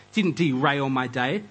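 normal talking voice

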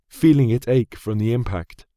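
plain reading voice

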